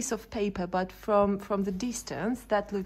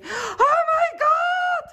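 high drawn-out shriek of fright, slowed right down; reliving the scare in a high, slow shriek; frightened shriek; 7 dB louder, slower than usual. Retold or imitated. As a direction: scream it the way you did when you saw it. Retelling her fright on seeing it.

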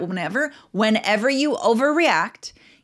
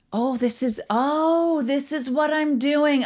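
sudden self-noticing; 4 semitones higher, slower than usual. Shown as a demonstration. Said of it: "Just noticing".

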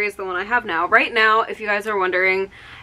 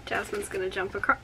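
ordinary talk, nothing marked

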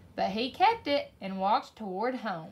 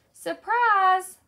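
high, loud call of surprise; shout; 7 semitones higher, 9 dB louder than usual. A narrator's reading. Brew surprising P J on his walk home, shouted. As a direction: call it out big and drawn out. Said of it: "they shouted".

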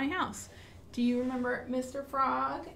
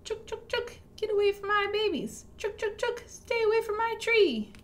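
high, loud chittering squirrel warning; fierce mama squirrel; 9 semitones higher, 3 dB louder than usual. Acted. Mama squirrel scaring animals from her babies, high loud fierce. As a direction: chase off the big animals from her tree. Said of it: "She chitters at them".

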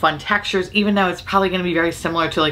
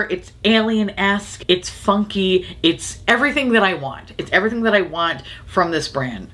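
ordinary delivery

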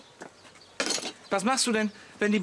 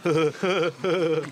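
short scornful laugh; mocking laugh; 2 semitones lower than usual. Neighbour laughing off the mafia remark.

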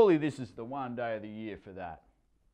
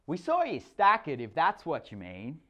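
high, loud deadpan put-down; sarcastic girl; 5 semitones higher, 5 dB louder than usual. A narrator's reading. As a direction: cut him down to size. Sarcastic stepdaughter.

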